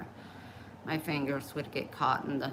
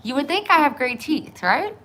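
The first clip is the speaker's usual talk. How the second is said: much higher and louder, half mocking; raised, higher mock-proud question; loud rhetorical question; 10 semitones higher, 10 dB louder than usual. Real, not acted. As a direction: call it out loud and high. Loud high rhetorical ask.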